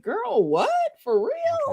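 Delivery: an incredulous, squeaky double take; disbelief. Retold or imitated. Incredulous reaction to TV drama.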